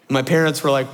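usual speaking voice

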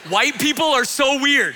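loud, high, slow mock bewilderment; exaggerated, drawn-out disbelief; Santos. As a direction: blurt it as a baffled onlooker's thought. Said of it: "Santos sitting in the back seat thinking".

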